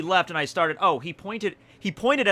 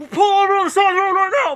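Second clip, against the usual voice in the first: fast, high, loud mock command; barked order; 9 dB louder, much faster than usual. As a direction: bark it like an angry cop. Cop orders him over.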